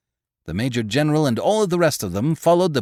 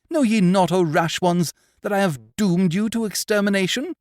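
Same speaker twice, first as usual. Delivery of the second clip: higher, louder melodramatic challenge; grand defiance; 9 semitones higher, 4 dB louder than usual. A narrator's reading. Frederick threatening the pirates, melodramatic. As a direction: declaim it like a stage hero.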